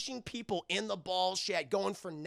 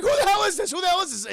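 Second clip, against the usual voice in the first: high, loud, startled mock questioning; alarmed demand; 12 semitones higher, 9 dB louder, faster than usual. Streamer voicing Terry hearing a stranger, startled and loud. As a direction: yelp it twice in mock alarm.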